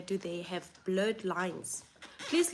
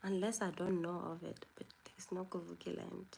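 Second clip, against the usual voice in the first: whispered aside; 3 semitones lower, 7 dB quieter, faster than usual. Real, not acted. Whispered aside about Kuvikiland.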